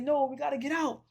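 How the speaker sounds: high-pitched retold protest; mock frantic objection. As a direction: act out her urgent refusal. Woman argues to escape.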